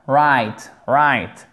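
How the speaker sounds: Right said as rait without the w; silent w. Shown as a demonstration. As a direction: drop the w in Right. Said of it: "On ne prononce pas le W".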